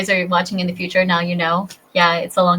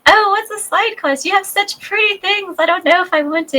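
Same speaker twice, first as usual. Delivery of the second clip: much higher, sweet put-on little girl; 10 semitones higher than usual. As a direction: squeak it like a shy little girl.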